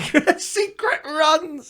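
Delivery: high, slow laughing repeat of secret runs. Real, not acted. Friend laughing at the idea of secret runs, laughing high. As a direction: giggle the words out, drawn out. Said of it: "do it in secret".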